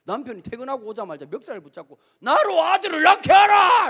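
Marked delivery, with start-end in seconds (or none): 2.2-3.9 high, loud, slow shouted demand; mock furious demand. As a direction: grab him by the collar and yell it. 라헬이 멱살 잡고 성질.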